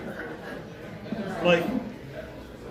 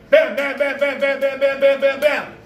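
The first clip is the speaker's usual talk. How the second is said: fast, loud, high volley of bams; machine-like hammering on a door; imitated door banging; 5 semitones higher, 5 dB louder than usual. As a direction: bang out the knocks without letup.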